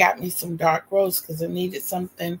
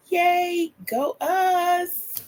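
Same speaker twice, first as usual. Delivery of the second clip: much higher, bright little cheer; excited cheer; 11 semitones higher, much slower than usual. Real, not acted. Thanking viewers for subscribers, a high excited cheer. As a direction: celebrate the milestone with a squeal.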